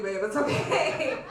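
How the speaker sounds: usual speaking voice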